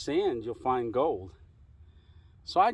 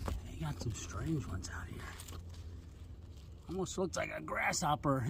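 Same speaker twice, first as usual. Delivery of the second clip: whisper; 14 dB quieter than usual. Real, not acted. Whispering on the trail.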